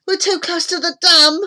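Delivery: high, loud alarmed shout. A narrator's reading. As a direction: shout a warning of danger. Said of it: "shouted Kieran".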